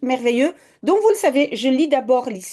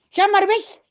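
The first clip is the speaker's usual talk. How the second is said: high and a bit louder, sending him off; mock shout; 8 semitones higher, 4 dB louder than usual. Janneke sends Jip away with a high cry. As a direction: tell him to go, sharp and high. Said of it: "schreeuwt Janneke".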